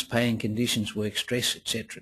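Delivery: ordinary talk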